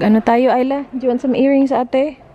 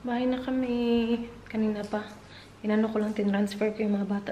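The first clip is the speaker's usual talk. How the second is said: hushed voice; 3 semitones lower, 12 dB quieter, faster than usual. Real, not acted. Mother home with sleeping kids, talking in a hush. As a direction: keep it hushed, barely voiced.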